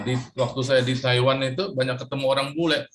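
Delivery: normal talking voice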